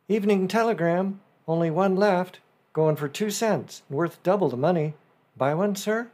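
businesslike newsboy call. A narrator's reading. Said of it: "the businesslike tone of the newsboy".

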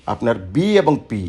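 normal talking voice

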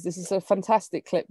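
ordinary talk, nothing marked

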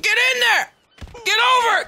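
high yelled urging; shouting. Real, not acted. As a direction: yell at him to get over the edge. Urging character off pier.

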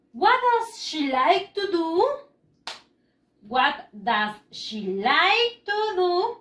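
slow, clear quiz question; slow question reading. Shown as a demonstration. Teacher reading a question slowly to pupils. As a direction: read the question slowly to the class. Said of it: "teacher reading quiz question".

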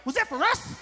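put-on a little kid begging Dad; excited kids. Kids in the car beg their dad, high eager child voice. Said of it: "They're like".

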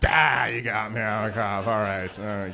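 loud "ah" settling into resigned admission; mock confession. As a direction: play a busted undercover cop giving up. Acts out caught cop giving up.

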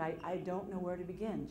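quiet, low and halting; subdued, understated tearfulness; retold tearful caller. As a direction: imitate a caller starting to cry, softly. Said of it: "she started to cry".

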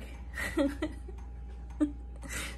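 a long, voiceless, airy laugh; breathy silent laugh. Real, not acted. Admitting she stayed at work, a breathy silent laugh. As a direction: let the air out in a soundless laugh. Laughs about not seeing dad.

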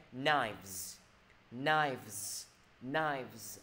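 KNIVES as "nives" with buzzing v-z; model pronunciation. Shown as a demonstration. Teacher modelling a hard sound, KNIVES with clear v-z ending. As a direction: stretch KNIVES, keeping k silent and ending voiced. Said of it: "Thầy đọc cái gì đây?".

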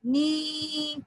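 नी held long on one note; long vowel. Shown as a demonstration. Teacher repeating the syllable for children, long-held नी. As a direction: sustain the ee in नी. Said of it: "ना में बड़ी की मात्रा जोड़ी".

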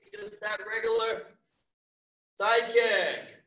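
normal talking voice

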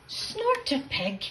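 usual speaking voice

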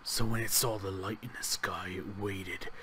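low, soft storyteller voice; hushed story narration. A narrator's reading. Gamer reading the found note aloud, hushed narration. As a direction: read it quietly and gravely, letting pitch sink.

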